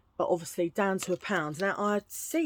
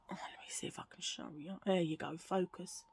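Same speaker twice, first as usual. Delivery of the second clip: quick hushed whisper; fast whispered aside; 2 semitones lower, 11 dB quieter than usual. Real, not acted. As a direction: mutter it under your breath. Trying to show the camera.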